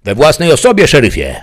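high, loud yell back; shouted reply. A narrator's reading. Bandit answers the sheriff outside, shouting. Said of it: "wykrzyczał".

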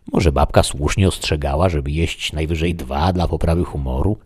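ordinary delivery

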